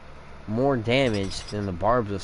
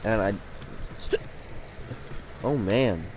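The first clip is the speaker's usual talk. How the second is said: speech broken by hiccups; fighting the hiccups mid-sentence; 4 dB quieter than usual. Real, not acted. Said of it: "I got the hiccups pretty bad".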